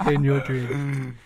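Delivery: slow, laughing put-down. Real, not acted. Laughing at his Wolves-supporting friend, slowly. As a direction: laugh it off while answering.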